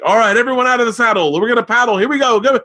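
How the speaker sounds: rally the class like a spin coach; coach calling out. Retold or imitated.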